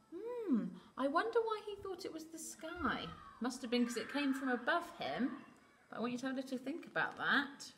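plain reading voice

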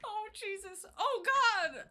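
high, loud panicked laughing screams; scared horror game streamer. Real, not acted. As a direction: freak out while laughing, chased.

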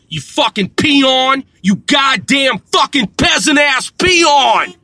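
enraged slow-motion bellow of contempt; furious shout. Acted. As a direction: yell it down at him like he's dirt. Ranting at online critic.

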